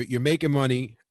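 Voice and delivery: ordinary talk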